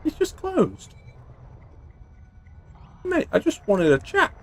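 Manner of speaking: high-pitched mock pleading; mock-hurt little plea; put-on plaintive voice.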